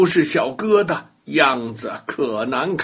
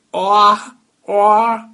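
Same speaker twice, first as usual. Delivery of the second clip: high, loud croaky wa wa; toad croak; 5 semitones higher, 5 dB louder than usual. Acted. Toad croaking at grandma instead of her name. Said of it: "叫出来的声音却是".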